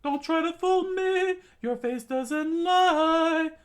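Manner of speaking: loud, very high screech, last word drawn out; furious shouting. Acted. Cook accuses his captain of lying, furious shouting. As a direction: yell an accusation in piercing falsetto.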